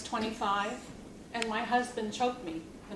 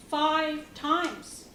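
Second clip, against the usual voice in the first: FIVE times, raised in pitch and drawn down; anguished emphasis; 5 semitones higher, 3 dB louder than usual. Acted. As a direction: hit "Five times" as a painful, higher-pitched refrain. Grim testimony, count repeated emphatically.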